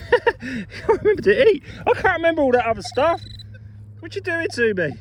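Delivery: high-pitched comic protest; mock exasperation. Mock plea of an overwhelmed forgetful man.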